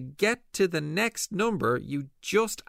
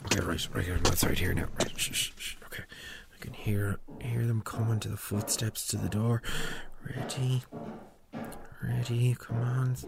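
soft unvoiced whisper while hiding; 3 semitones lower, 4 dB quieter than usual. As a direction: hush it, hiding by the door. Hiding at the door.

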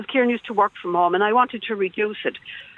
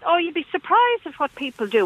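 higher-pitched mock Dublin accent; retold line in Dublin accent, pitched up; imitated Dublin accent; 7 semitones higher than usual. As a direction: mimic a phone agent with a real Dublin accent. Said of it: "with a real Dublin accent".

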